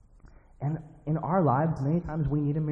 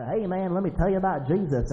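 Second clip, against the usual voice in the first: imitation Southern accent; 6 semitones higher than usual. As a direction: put on a friendly Southern twang. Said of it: "he's got this southern accent".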